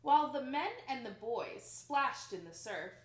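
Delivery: ordinary delivery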